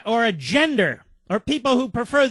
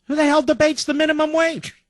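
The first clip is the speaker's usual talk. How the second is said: high-pitched, exasperated demand; real exasperation, pitched up high; indignant outburst; 9 semitones higher than usual. Real, not acted. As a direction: fire it out as an outraged rhetorical question. Indignant rhetorical question in political rant.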